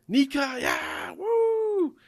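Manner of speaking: high, loud whooping cheer; hammed-up fan cheering; cheering giants. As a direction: whoop like an adoring crowd. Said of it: "the Giants are just loving it".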